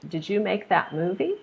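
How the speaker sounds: yes-no question lifting up through "movie"; rising question. Shown as a demonstration. Teacher modeling a yes-no question, rising tone on "movie". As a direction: voice climbs at the end on "movie". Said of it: "make your voice rise at the end".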